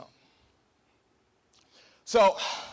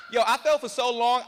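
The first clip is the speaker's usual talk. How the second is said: ordinary delivery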